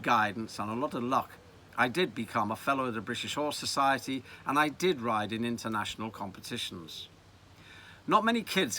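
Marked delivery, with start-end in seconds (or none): none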